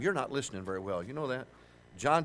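usual speaking voice

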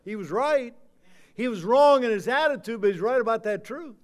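loud, high-pitched pulpit proclamation; forceful preaching. Acted. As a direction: drive the warning home, stretching the final words.

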